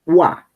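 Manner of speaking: "wa" with a rounded w glide. Shown as a demonstration. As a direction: glide from w straight into "wa". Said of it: "W pronunciamos como".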